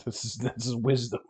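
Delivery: ordinary talk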